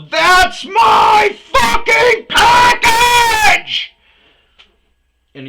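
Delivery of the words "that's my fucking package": very slow, enormous high scream; furious scream. Retold or imitated. Leaning into the driver's window, furious slow scream. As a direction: scream every word long, right into their face.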